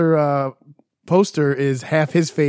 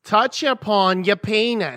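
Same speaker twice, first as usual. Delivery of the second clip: higher, slow, sultry nasal drawl; Fran Drescher voice; 7 semitones higher, slower than usual. Pretend. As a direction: purr it like a TV nanny. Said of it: "just talk like Fran Drescher for me".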